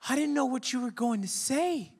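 put-on high-pitched, worried old lady. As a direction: fuss over him like a frightened grandmother. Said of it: "she was like".

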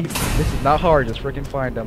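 ordinary delivery

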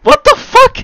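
high shocked yelp; shocked outburst. Real, not acted. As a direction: blurt it out in disbelief. Shocked by giant squid.

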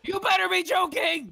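very high, squealed warning; outraged disbelief. Real, not acted. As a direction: squeal it, refusing to believe it. Refuses to believe dogs dead.

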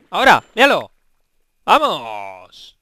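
loud celebratory shouts; excited triumph. Real, not acted. Gamer finally catching a fish, excited shouting.

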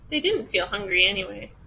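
usual speaking voice

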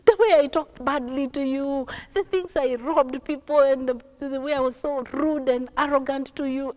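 high wailing plea, easing gradually; acted crying, loud at first; tearful apology. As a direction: cry out an apology, then pour out the confession. Son begs father's forgiveness in tears.

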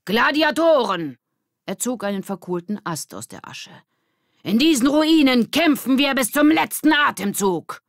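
very high, loud shouting; battle cry. Acted. Mitch shouting a gladiator battle cry. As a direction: roar it like a gladiator.

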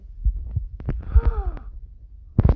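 sharp airy gasp of surprise; loud surprised gasp. Real, not acted. Gamer gasping at in-game loot, airy gasp. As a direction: gasp as if struck by the prize.

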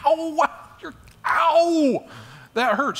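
high, loud yelp of pain, acted out; cry of pain. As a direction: cry out as the ankle is pressed hard. Mother pushes on his hurt ankle.

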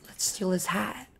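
soft conspiratorial whisper. Retold or imitated. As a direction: keep it secret and low. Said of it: "whispering to me".

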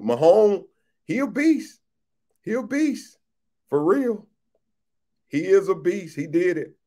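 high-pitched, amazed hype; hyped admiration. Real, not acted. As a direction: rave about the player in a high voice. Hyping up Mahomes' comeback win.

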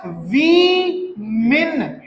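वीमेन very slowly, "WI ... min"; plural women pronunciation. Shown as a demonstration. Teacher teaching the plural, वीमेन as slow "wi-min". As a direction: split वीमेन, ending "min" not "men". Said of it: "इनकी की है".

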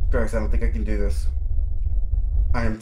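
loud, rattled protest; spooked gamer pleading out loud; real alarm. Real, not acted. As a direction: call out loudly, too scared to go on. Scared player after jump scare.

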